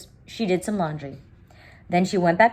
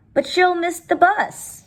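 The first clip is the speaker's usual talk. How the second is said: worried mother; 13 semitones higher, 5 dB louder, slower than usual. Acted. Mama worried about the bus.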